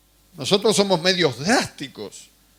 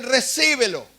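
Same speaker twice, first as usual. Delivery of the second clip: higher, insistent coaxing; urging leaders; 6 semitones higher than usual. Retold or imitated. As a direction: push them to receive it, pitched up. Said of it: "me ponían las manos y me decían". (líderes insistiendo, voz más aguda)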